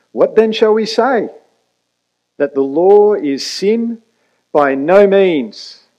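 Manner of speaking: loud, high, slowing into the final words; emphatic reading. Shown as a demonstration. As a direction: read it out with full force, hitting no hard. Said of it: "We hear that emphatic".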